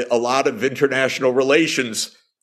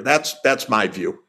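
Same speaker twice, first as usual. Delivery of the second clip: ordinary talk, nothing marked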